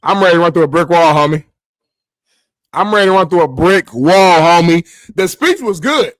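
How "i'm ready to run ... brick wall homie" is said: loud, fast fired-up yell; hyped shout. Pretend. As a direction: roar like you could run through a wall. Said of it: "joking hype".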